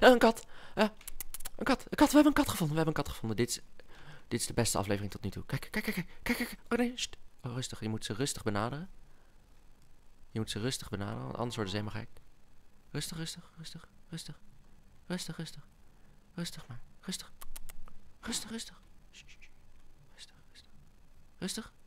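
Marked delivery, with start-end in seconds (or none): 0.0-3.1 high, excited outburst of discovery; excitado. Real, not acted. Spots a cat at last. 5.4-7.2 high, softer, urgent squeak; excited hush. Real, not acted. Hushing himself near the cat. 12.9-21.7 high, very soft coaxing; whispery high shushing to a skittish animal; hushed soothing. Real, not acted. Calming cat while creeping closer.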